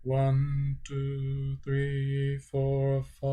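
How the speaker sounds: held flat tone on each count; staircase pitch. Shown as a demonstration. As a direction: keep each number level like a step. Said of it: "So when you do it".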